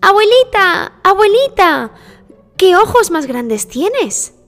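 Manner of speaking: put-on high, loud child exclamation; high-pitched childlike astonishment; amazed little girl. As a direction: marvel wide-eyed as a little girl. Girl marvels at disguised wolf.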